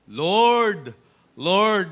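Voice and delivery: loud mooing call of Lord; cow praying. Retold or imitated. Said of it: "Ibig sabihin nun, "Moo, moo".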